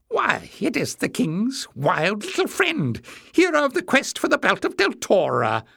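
high-pitched, mocking little man; jeering. A narrator's reading. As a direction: jeer in a high, needling voice. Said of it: "Jinx jeered".

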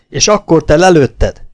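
loud accusing cry; shouting at him. Acted. Girl crying out at the man, loud accusing shout. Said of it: "kiáltott a férfira".

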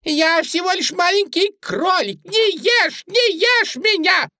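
very high, loud bunny squeal; piping little rabbit voice; squeaky pleading. A narrator's reading. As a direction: beg for your life in a tiny squeak. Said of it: "продолжала пищать Сьюзи".

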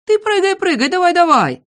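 very high, excited goading; high-pitched, insistent retort; heated urging. Acted. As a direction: egg them on in a squabble. Acted quarrel, urging to jump.

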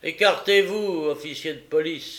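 loud, high rough order; brutal cry. A narrator's reading. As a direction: order the officer off harshly. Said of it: "s'écria Fang avec brutalité".

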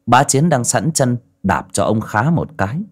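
plain reading voice

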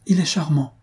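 understated swoon of admiration; rapturous. A narrator's reading. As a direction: answer with a touch of delighted transport. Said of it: "répondit Minella avec transport".

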